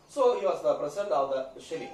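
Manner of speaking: usual speaking voice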